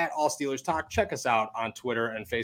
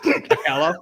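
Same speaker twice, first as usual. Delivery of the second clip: unsure try, laughing; 4 semitones higher, 5 dB louder than usual. Real, not acted. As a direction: laugh through the name. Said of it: "I don't know".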